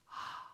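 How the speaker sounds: soft open-mouthed breath, ah; an airy sigh of ah; breathy ah. Shown as a demonstration. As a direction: let it out as pure breath. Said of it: "That final syllable is all breath".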